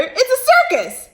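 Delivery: butterfly contradicting its friend; squeaky butterfly. Acted. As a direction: protest in a tiny high voice. Second butterfly disagrees.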